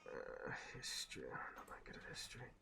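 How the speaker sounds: whispered aside under the breath. Real, not acted. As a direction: murmur it to yourself. Aside during dice roll.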